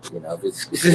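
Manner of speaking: normal talking voice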